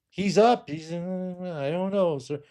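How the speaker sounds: high, flustered excuse; mock receptionist. Receptionist brushing off the patient, high flustered. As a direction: fumble the excuse for the secretary. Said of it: "receptionist answers patient".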